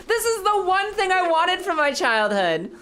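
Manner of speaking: high, tearful laughing delight; laughing with joy. Real, not acted. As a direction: laugh through happy tears. Laughing, near tears with joy.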